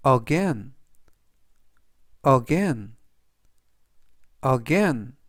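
careful, spaced-out vocabulary drill; slow model reading. Shown as a demonstration. Teacher reviewing words for learners to repeat, slow careful reading. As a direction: read it three times, leaving room to repeat. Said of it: "제가 천천히 세 번씩 읽어드리고요".

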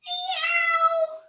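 very high, loud meow; cat meow. Acted. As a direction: yowl like a cat. The cat meows.

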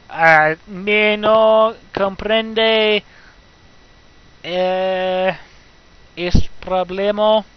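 slow, drawn-out mock Spanish. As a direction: stretch each word out in bad fake Spanish. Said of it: "Terrible Spanish grammar".

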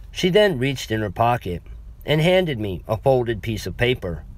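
plain reading voice

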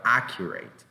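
"accurate" with an open first A; open-mouthed A starting "accurate"; pronunciation model. Shown as a demonstration. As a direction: model "accurate" as a careful single word. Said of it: "la pronunciación es".

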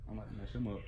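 low, hushed aside; quiet aside. Real, not acted. Prankster to listeners while waiting for the manager, hushed aside. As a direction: drop the voice right down, conspiratorial.

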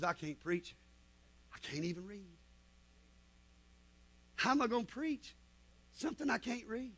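very soft, subdued inner voice; hushed self-doubt. Retold or imitated. Young preacher doubting his calling, hushed self-doubt.

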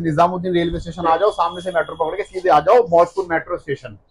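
usual speaking voice

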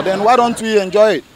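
ordinary delivery

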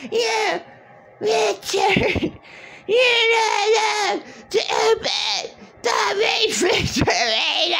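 high shrieking mock of his mother; mommy screaming. His mother screaming at Richard over the fridge, shrill mock. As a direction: screech it like a nagging mother. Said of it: "fucking screamed at you that".

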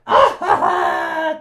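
a loud burst of breathy villain laughter. Acted. Joker laughing at Bruno for being found out, loud breathy burst. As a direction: laugh out loud at his rival.

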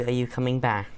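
normal talking voice

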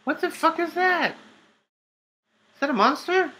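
very high-pitched, startled squeak; alarmed bewilderment. Real, not acted. Player spotting a possible monster, alarmed. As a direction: shoot up high in baffled alarm. Sees a creature in game.

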